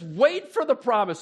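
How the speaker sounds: thrilled rallying call; pumped-up Jesus. Shown as a demonstration. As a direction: announce it like big news to the team. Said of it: "this is where my mind goes when I hear this".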